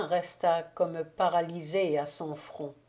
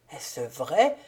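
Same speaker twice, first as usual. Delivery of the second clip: dazed disbelief; 5 semitones lower than usual. A narrator's reading. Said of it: "répéta Maximilien égaré".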